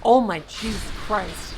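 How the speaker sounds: loud, slow, stunned outburst; shocked exclamation. Real, not acted. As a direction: let the shock drag it out. Shocked at game danger.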